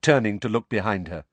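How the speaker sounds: ordinary delivery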